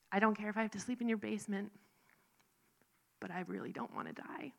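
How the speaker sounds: a rushed plea that collapses into a whisper; desperate whisper. Retold or imitated. Begging the treatment center worker, in a desperate whisper. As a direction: beg softly, voice breaking down to breath.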